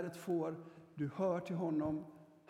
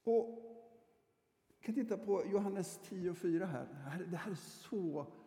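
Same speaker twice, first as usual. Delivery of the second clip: normal talking voice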